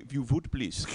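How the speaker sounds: imitated Indian accent, "would" said as "vood"; soft Indian English with V for W. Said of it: "with the W".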